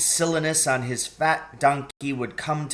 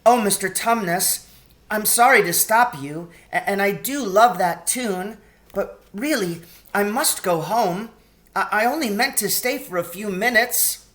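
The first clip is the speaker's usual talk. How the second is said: put-on young girl Lucy; 8 semitones higher, 4 dB louder than usual. A narrator's reading. Lucy voiced by reader.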